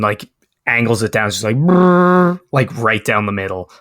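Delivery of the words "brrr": high drawn-out brrr of a Gatling gun; imitated gunfire sound; gun noise. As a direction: rattle it out like a machine gun. Mimicking Gatling gun fire.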